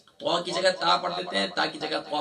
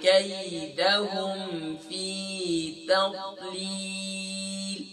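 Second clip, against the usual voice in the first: slow, melodic Quran recitation; measured chanted Arabic recitation; Quranic recitation; 3 semitones higher, much slower than usual. Shown as a demonstration. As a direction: recite with tajweed, drawn out. Recites Surah Fil with tajweed.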